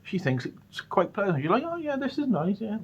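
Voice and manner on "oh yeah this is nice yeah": high, soft pleased mum voice; a mock mum, politely approving; imitating mum. Mum flipping through his holiday photos, high and soft.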